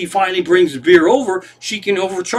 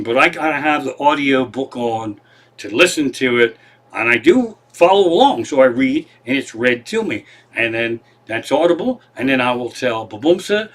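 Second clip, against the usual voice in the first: ordinary talk, nothing marked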